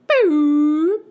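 loud, high air horn imitation; mouth air horn. Shown as a demonstration. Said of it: "I can't do an air horn".

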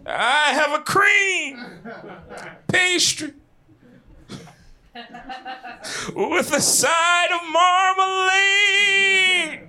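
high, slow, quavering preacher voice ordering food; ghostly Doctor King voice. Retold or imitated. Doctor King ordering at a sandwich shop, slow ghostly preacher voice. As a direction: stretch each word like a booming ghost. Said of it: "Why he sound like a ghost".

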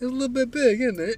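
high, loud questioning British lilt; bad British accent. Shown as a demonstration. Showing a friend's trick for accents, high bad British accent. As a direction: lift it into a mock British question. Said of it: "that's, that's my British accent".

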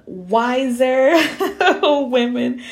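wiser sung out high and loud through a laugh; playful laughing correction. Shown as a demonstration. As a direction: laugh as you swap in wiser for old. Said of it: "'cause I don't wanna say old".

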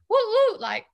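high, loud woo then playful chant; silly party cheer; mock party whoop. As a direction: hype up the dog's name. Hyping her dog's name Party.

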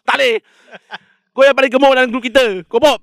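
high, loud whiny schoolboy; protesting kid voice. Acted. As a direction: squeal like a kid refusing a nickname. Voicing a fat kid protesting.

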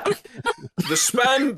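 Monty Python woman. Pretend. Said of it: "a Monty Python woman".

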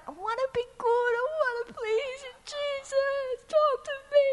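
high, pleading little voice; mock-childish plea to Jesus. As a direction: beg like a small child.

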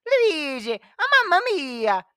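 high, loud Italian Mario; Mario impression. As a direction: shout it like Mario. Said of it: "It's a me, Mario".

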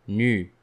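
nu said with a rounded French u; syllable demo. Shown as a demonstration. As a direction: isolate the syllable nu. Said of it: "The first sound is".

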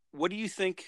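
ordinary talk

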